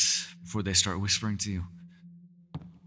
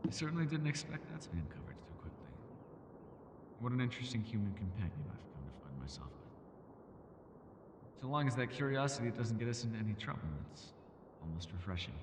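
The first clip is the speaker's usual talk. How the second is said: much softer, private thoughts murmured alone; quiet musing to herself; 14 dB quieter than usual. Acted. Alone after the guest leaves, murmuring her thoughts much softer. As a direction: hush it down as if nobody is left to hear.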